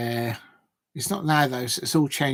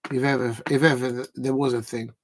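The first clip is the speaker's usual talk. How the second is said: ordinary delivery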